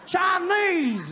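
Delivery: high, loud mocking voice with laughs; loud high parody of fearmongers; mock alarmist. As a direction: ham up a panicky know-it-all. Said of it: "I see people say".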